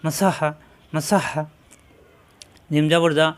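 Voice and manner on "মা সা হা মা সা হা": মা সা হা read quickly as one word; now say it fast, short vowels; quick smooth recitation; quicker joined reading. Shown as a demonstration. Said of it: "আর কত উচ্চারণ তাড়াতাড়ি".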